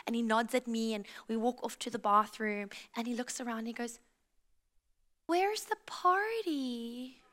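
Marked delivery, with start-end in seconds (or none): 5.3-7.2 put-on small child asking slowly in American accent; slow, puzzled American child voice; American toddler accent. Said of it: "work on my American accent".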